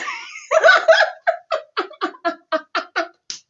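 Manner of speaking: shrieking laugh; real loud high laugh; loud high cackle. Real, not acted. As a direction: burst out in a high helpless cackle. Big laugh after punchline.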